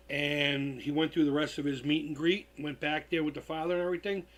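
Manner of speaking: ordinary delivery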